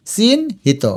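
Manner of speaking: চিহ্নিত as a drawn-out chin, then hito; broken into parts. Shown as a demonstration. Teacher sounds it out for spelling, চিহ্নিত in parts. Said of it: "ভেঙে ভেঙে লিখবে".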